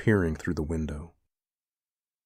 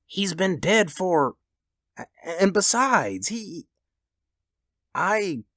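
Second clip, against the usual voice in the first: shaking voice; 12 semitones higher than usual. A narrator's reading. Old man warning wife of mangled son, voice shaking. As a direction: falter and break off in dread. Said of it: "and his voice shook".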